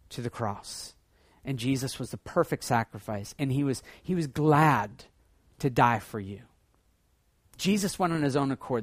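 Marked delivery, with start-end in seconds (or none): none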